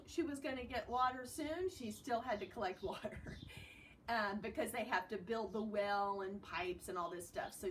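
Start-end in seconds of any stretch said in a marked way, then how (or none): none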